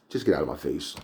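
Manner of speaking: low, quiet retold brush-off; mad dismissal. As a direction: imitate a fed-up father, voice dropped low. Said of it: "He's mad".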